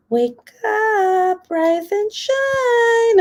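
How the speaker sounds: high, slow sing-song wake-up. Retold or imitated. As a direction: coo him awake, drawn out and high. Gentle sweet wake-up.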